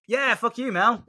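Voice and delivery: lightly voiced Toadie, jeering at Mel; mock tough guy. Retelling Toadie jumping in, a hint of cocky jeer. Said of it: "And then Toadie's like".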